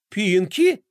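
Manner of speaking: outraged at being passed over; indignant. Acted. Gloria hears Fly picked Pinky, indignant. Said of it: "возмущённо переспросила".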